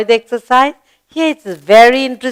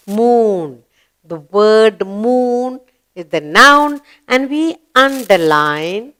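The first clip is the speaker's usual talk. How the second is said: ordinary talk, nothing marked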